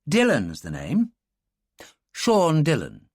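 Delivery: calm, slow Irishman naming himself; a light touch of cool confidence; cool Dylan. Acted. Dylan revealing himself to the girl, cool and calm.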